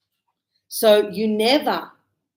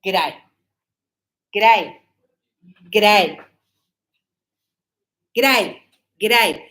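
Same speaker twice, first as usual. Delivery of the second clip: g'day clipped to two sounds, Aussie style, louder with each repeat; Australian g'day; 6 dB louder, slower than usual. Shown as a demonstration. Teacher shows students the Australian greeting, Aussie accent. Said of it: "They say".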